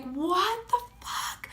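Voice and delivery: high, slow, stunned what the fuck; stunned disbelief. Retold or imitated. Friends stunned after hearing three shots, high slow disbelief. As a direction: gasp it out in shock, high and drawn. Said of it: "we're like".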